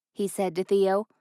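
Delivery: ordinary delivery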